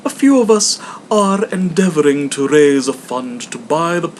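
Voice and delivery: a courteous charity collector; hammed-up polite visitor; portly gentleman.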